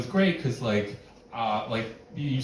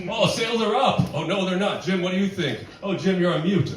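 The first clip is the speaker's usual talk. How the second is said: impression of a meeting; 7 semitones higher than usual. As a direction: ham up a video call full of coworkers. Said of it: "do an impression of the meeting".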